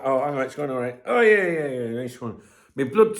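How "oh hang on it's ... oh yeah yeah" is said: usual speaking voice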